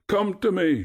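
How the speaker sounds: high echoing call; shouting. A narrator's reading. Echo throws Narcissus's words back, shouting high. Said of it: "shouted Echo".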